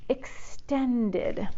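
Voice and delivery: soft, drawn-out word; languid, refined delivery; elegant extension. A narrator's reading. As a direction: let the word unfurl slowly. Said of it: "She didn't stretch".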